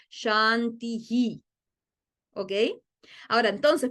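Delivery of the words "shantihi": "shantihi" said slowly, with hi added at the end; echoed vowel. Shown as a demonstration. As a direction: draw out shanti-hi. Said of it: "Y en este caso". (pronunciación lenta: shanti-hi)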